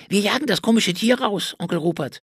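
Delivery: put-on high child voice, eager and busy; a lively nephew reporting on the chase; little boy shouting the news to his uncle; excited boy. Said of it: "informierte ihn Wilfried".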